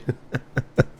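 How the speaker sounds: breathy chuckling after a joke; wheezy little run of laughter; chuckle. Real, not acted. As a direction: laugh at your own jab. Laughs at own jeer.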